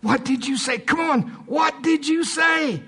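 high, wailing demand, last words stretched; hammed-up high-pitched begging; desperate pleading. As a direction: imitate frantic onlookers begging for the secret. Onlookers begging, louder insistence.

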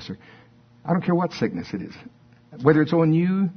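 ordinary delivery

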